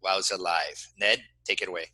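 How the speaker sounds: ordinary talk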